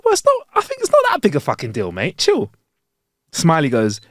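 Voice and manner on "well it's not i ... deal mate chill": high, breezy brush-off; high laid-back chill-out voice; mock casual reassurance. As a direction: toss it off high and light. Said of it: "I go".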